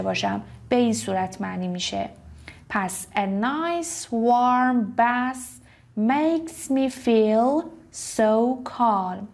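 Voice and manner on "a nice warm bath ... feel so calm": slow, raised reading; very slow model sentence. Shown as a demonstration. Teacher reading an example sentence slowly. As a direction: read it out slowly and clearly. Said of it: "model sentence repeated slowly".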